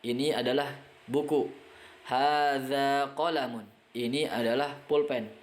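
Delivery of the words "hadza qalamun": Hadza masjidun drawn out slowly and clearly; teacher modelling Arabic slowly; slow model reading. Shown as a demonstration. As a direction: read it slowly for students to repeat. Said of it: "model Arabic readings slowly".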